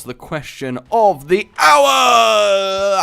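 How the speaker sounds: hammed-up announcer proclaiming. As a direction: boom it out like a game show host. Game-show style announcement.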